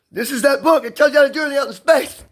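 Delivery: high, hurried promo declaration; excited book pitch. Acted. Acts out announcing his own book.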